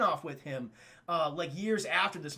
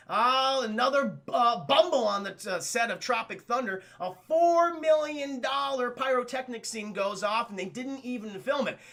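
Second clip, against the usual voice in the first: raised, punchy gossip TV presenter; entertainment-show host; 5 semitones higher, 4 dB louder than usual. Acted. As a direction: announce it like scandalous showbiz news. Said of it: "like an Access Hollywood type".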